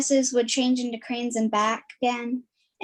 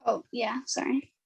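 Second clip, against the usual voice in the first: whisper; 6 dB quieter than usual. Real, not acted. Narrator caught off screen.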